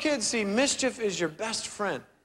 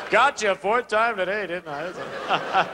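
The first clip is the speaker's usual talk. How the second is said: lightly voiced teasing father, laughing; a hint of a smug dad; gloating dad; slower than usual. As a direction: chuckle it at your kid. Dad gloating after prank.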